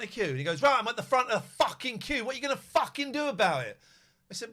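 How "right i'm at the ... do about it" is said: high loud confrontation; imitating a loud challenge; aggressive challenge. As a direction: square up and provoke. Man confronting at queue front.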